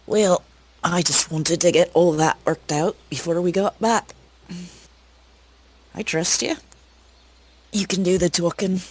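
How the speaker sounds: a hint of a sly, teasing woman; Dev in character. Rogue Dev reassuring her companion, lightly voiced.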